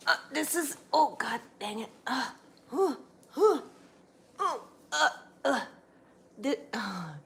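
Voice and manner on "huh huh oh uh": flustered laugh. Real, not acted. Dog wriggling off camera.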